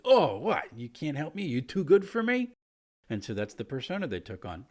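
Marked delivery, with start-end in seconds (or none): 0.0-2.5 indignant, higher and louder; huffy aggressive tourist taking offence; offended. Retold or imitated. Kobold taking offence at the players, indignant and loud. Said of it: "like the offended".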